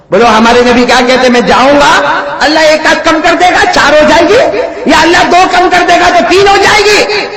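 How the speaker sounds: loud, high preaching that builds with each number; full-force preaching. Acted. Preacher counting the prayers down to the crowd, loud and rising. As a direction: call it out to the crowd like a fiery preacher.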